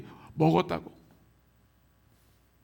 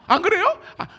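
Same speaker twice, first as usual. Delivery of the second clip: normal talking voice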